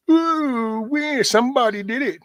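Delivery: high, whiny mock of someone dodging blame; squeaky high mockery of excuses. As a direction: sneer it like a blame-dodger. Mocking blame-shifters.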